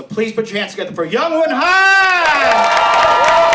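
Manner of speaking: high, loud, drawn-out announcer shout; hype introduction. Real, not acted. Host bringing the guest on stage, loud announcer shout. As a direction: call the guest up to cheers.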